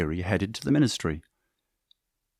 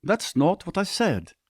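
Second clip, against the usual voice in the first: retort; 9 semitones higher, slower than usual. Acted. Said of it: "Uriel retorted".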